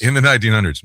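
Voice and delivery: usual speaking voice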